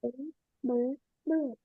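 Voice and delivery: Bird, bird, bird mumbled very softly; mumbled repetition. Real, not acted. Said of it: "what are you doing, [name]".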